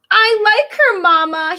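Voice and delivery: a hint of a high eager prince; lightly voiced prince. Prince telling his mother he likes the girl, lightly voiced.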